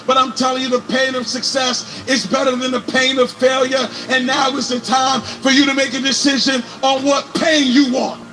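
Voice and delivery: ordinary delivery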